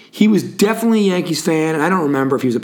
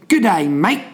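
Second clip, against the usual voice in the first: playful Aussie greeting in a put-on accent, pitched a bit higher; Australian accent; 4 semitones higher than usual. Podcast host greets Sydney listeners, mock Australian accent. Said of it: "Whether that was a good or bad accent".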